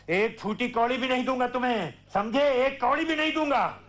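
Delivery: high-pitched, emphatic outburst; angry refusal. Acted. Father refusing his son money, heated and final. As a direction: refuse outright, pitch climbing to the end.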